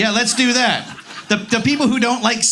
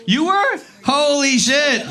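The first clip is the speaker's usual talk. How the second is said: high-pitched, amazed reaction; real astonishment; 5 semitones higher, slower than usual. Real, not acted. Comic learns audience member was at Woodstock, astonished. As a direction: draw out the swear in disbelief.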